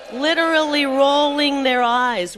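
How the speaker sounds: loud, slow rally shout; rally speech. Real, not acted. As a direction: call out the media loudly. Calling out the media.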